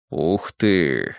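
low, breathed-out wow; hushed, low amazement; awed exhale. A narrator's reading. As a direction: let it out on a breath, impressed. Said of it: "выдохнул председатель".

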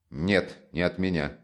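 ordinary delivery, nothing marked

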